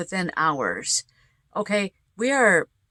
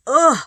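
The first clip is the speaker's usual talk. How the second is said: loud ugh then coughs; disgusted coughing; 7 semitones higher, 8 dB louder than usual. Real, not acted. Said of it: "I just swallowed a bug".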